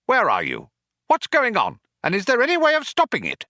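high, agitated penguin voice; urgent high-pitched squawking questions; quacked. A narrator's reading. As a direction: quack out the questions, speeding up at the end. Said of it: "he quacked".

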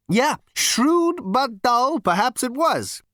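high, loud, slow character voice; Zaphod. Acted. Zaphod's figure voice in the story.

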